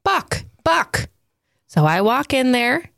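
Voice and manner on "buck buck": put-on high, small child's voice; little girl babbling a name; toddler voice. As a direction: say it like a tiny toddler pointing. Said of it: "and she says".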